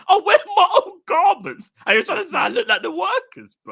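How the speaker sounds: mock indignation. Indignant retold thought.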